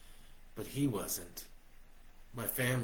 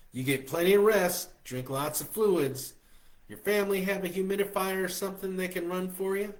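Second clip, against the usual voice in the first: higher, louder fussing woman; mothering Josie; 9 semitones higher, 7 dB louder than usual. A narrator's reading. Josie nursing him by phone, higher and louder. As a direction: fuss over him like a mother.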